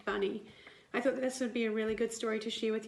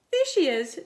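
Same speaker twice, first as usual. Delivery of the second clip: louder, higher found-her cry; excited shout; 6 semitones higher, 8 dB louder than usual. Acted. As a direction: shout on spotting the cat. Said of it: "shouted Nicky".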